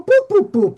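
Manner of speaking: high, loud mimicked boops; imitated booping. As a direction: answer back with high booping noises. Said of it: "And he'd go".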